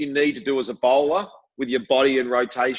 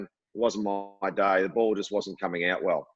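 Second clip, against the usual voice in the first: mock excuse; 6 semitones lower, 4 dB quieter than usual. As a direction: drag out the lame excuse, scornfully. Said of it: "because I hate just saying".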